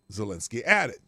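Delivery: normal talking voice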